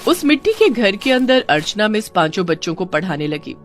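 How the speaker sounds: ordinary delivery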